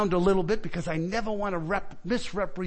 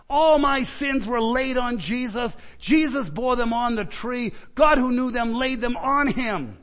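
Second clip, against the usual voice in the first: higher, emphatic pulpit declaration; impassioned preaching; 4 semitones higher, 3 dB louder than usual. Acted. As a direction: proclaim each phrase with conviction. Preacher's forceful testimony.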